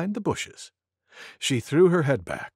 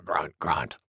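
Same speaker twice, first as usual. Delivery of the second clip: very low, softer grunted words; grunting wild boar; 12 semitones lower, 6 dB quieter than usual. A narrator's reading. Boar grunts after sneezing.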